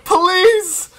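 high, drawn-out begging, half laughing, half crying; desperate pleading. Real, not acted. Streamer begs the summon screen for a good pull, pleading.

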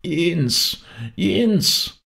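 put-on high, drawn cry of a dying old woman; shrieked distress cry. A narrator's reading. Dying woman calls for Jens, high cry of distress. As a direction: call out in desperate need, pitched high. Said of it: "kreischend, wie ein Notschrei".

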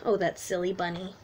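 ordinary delivery

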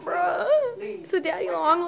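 high nervous laugh into an exclamation; freaked out, pitch jumping up; real spooked squeal; scared. Real, not acted. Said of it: "I'm fucking scared".

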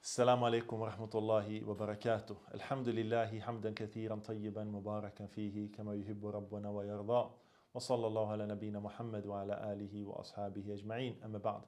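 fast formal Arabic sermon preamble; formal Arabic opening. Acted. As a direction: rattle off the ritual opening formula quickly.

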